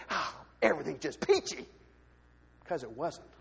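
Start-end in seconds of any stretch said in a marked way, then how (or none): 0.1-1.7 mock cheerful. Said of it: "put a smile on my face and say".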